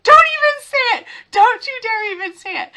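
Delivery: high, loud laughing plea; laughing kid protest. Retold or imitated. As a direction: beg through laughter not to hear it. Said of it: "he would start laughing".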